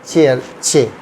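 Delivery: "चेयर, चे" with a clear ch onset; CH sound. Shown as a demonstration. Teacher repeating the CH example for learners, ch sound. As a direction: model the ch in "चेयर", then "चे" alone. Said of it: "चे की आवाज़ देता है".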